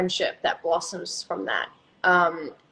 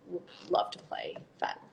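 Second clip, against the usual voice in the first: hushed whisper; whispering that she'd love to play Fatin; 14 semitones lower, 12 dB quieter than usual. Real, not acted. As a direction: whisper it like a confession. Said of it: "whispered confession".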